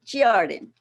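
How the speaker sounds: Giardim whispered, first syllable stressed. Shown as a demonstration. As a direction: breathe out Giardim, weight on GI. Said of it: "emphasis on the first syllable".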